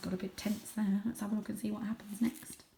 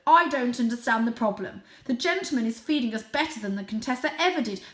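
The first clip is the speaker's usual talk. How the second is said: blustering father; 4 semitones higher, 7 dB louder than usual. Acted. Father dismisses worries.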